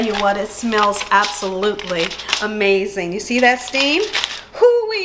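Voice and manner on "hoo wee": excited hoo-wee. Real, not acted. Reacting to the steaming pot.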